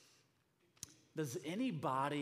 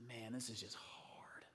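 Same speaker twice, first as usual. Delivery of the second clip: weary whisper; 3 semitones lower, 6 dB quieter than usual. Retold or imitated. Inner complaint quoted in sermon.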